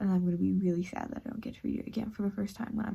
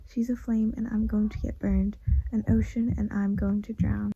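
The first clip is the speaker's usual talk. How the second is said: romantic story reading; 4 dB louder than usual. Acted. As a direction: read it like a swooning narrator. Reads a romantic passage aloud.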